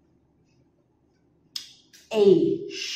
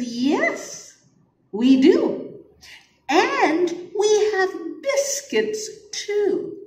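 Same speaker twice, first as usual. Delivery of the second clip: put-on cheerful boy; 12 semitones higher, 3 dB louder, slower than usual. Teacher voices boy, laughing.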